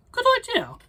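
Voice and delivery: very high loud squeal of approval; squeaky skeleton. Acted. Big skeleton agrees, in a high loud squeal. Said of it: "the big skeleton said".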